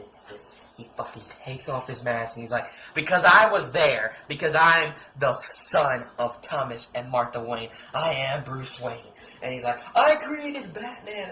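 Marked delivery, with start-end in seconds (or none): 2.9-9.1 loud, forceful hero's reveal; dramatic declaration. Retold or imitated. Batman unmasks before Chill, declaring it dramatically loud. 10.0-11.3 high, loud, drawn-out wail; mock horrified cry. Chill realising what he caused, in mock horror.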